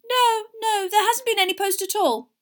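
high, loud, fretful voice; flustered, fussing reply; distracted. A narrator's reading. Mother answering Harry about his post, distracted. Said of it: "said Missus Weasley distractedly".

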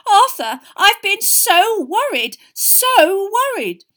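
high, loud worried wail; frantic worry. A narrator's reading. Wife running to her husband, frantic with worry. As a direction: draw out each "so" in high distress.

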